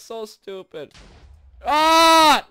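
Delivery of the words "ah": high, loud "ah"; frustrated scream. Real, not acted. Player missing the train, frustrated scream. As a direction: scream out at the miss.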